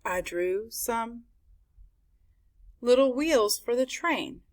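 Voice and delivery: ordinary delivery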